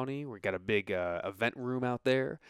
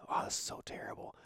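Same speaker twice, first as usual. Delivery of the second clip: soft, unvoiced self-critique under the breath; whispered aside; 7 dB quieter than usual. Real, not acted. Host judging their own accent attempt, whispered aside. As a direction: whisper it to yourself.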